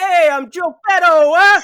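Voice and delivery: put-on loud, hammy Italian accent. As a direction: shout it in a cartoon Italian accent. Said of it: "an Italian accent".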